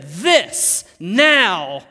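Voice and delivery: loud, drawn-out exclamation; big emphatic cry; shouting. Acted. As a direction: shout it out like Adam seeing his bride. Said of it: "Adam shouts".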